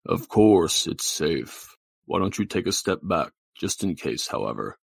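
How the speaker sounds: deep, slow voice of God; low, stately godlike voice; Mister Bub. Acted. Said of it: "he says".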